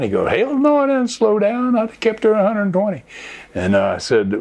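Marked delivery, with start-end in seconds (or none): 0.3-3.0 high-pitched, defiant comeback; emphatic retold refusal. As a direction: imitate a reckless friend scoffing at the idea. Acts friend's defiant answer.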